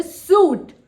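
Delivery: SUIT said as "soot" for suite; mispronounced suite, like suit; wrong pronunciation. Shown as a demonstration. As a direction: say suit with a long oo. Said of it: "Listen, that's wrong".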